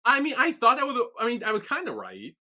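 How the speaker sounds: eagerly insisting he was right; excited protest. Real, not acted. Defending his theory, excited.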